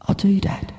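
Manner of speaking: awed whisper; hushed reply to Dad. Acted. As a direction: breathe it to the vision. Milo whispers to his dad.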